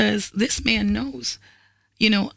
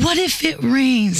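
slow, higher patronizing question; mock motherly condescension; 4 semitones higher, 3 dB louder, slower than usual. Mimicking friend like mother to six-year-old, condescending. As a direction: draw it out as if to a small child. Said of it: "Like a mother to a six-year-old child".

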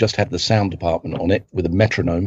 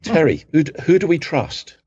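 higher, teasing director's voice; a lightly voiced sly director toying with his crew; sly Stanley; 9 semitones higher than usual. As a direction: needle him playfully, pitched up. Stanley teasing in retold story.

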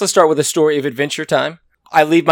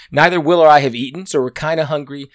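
normal talking voice

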